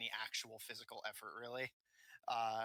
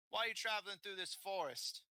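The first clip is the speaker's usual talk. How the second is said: high, quick bandit question; bandit leader; 9 semitones higher, 3 dB louder, faster than usual. Acted. Bandit to Lucien on the forest road, questioning. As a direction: interrogate briskly as the bandit.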